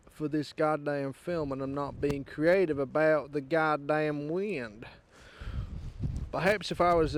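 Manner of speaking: put-on folksy southern accent, y'all style. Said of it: "enough of this accent".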